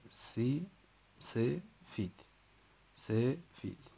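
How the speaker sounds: سفید broken slowly into سه and فید with a pause; syllable split. Shown as a demonstration. Teacher splitting سفید into two syllables for the class. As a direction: clap out سفید as سه then فید. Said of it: "حالا سفید چند بخشه؟".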